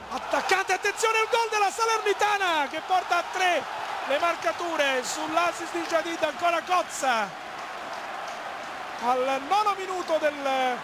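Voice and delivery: loud rising goal shout; breathless Italian goal commentary. Real, not acted. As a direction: erupt as the goal goes in. Third goal called live.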